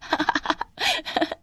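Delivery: high, airy cackle; acted derisive giggle; mocking laughter. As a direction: snicker at her in high bursts. Anju laughs at Radha.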